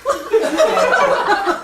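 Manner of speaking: high, loud laughing burst; cracking up hard at the memory; burst of laughter. Real, not acted. Telling friends about the photo, bursting into loud high laughter.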